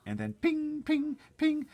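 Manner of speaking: high loud pings; ping it high and sharp; ping sounds. Acted. Narrator voicing hail bouncing off the shell.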